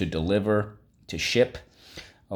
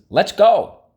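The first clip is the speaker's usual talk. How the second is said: high, loud "let's go"; excited cheer; 13 semitones higher, 11 dB louder than usual. Shown as a demonstration. Teacher cheering the meaning to her class, loud and high. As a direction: call it out brightly like a cheer.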